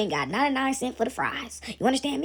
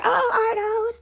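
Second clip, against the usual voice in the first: high, swooning sigh over a sweetheart; hammed-up lovesick cartoon voice; Helga; 11 semitones higher, much slower than usual. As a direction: moon over the beloved's picture, high and stretched. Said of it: "she would have his picture".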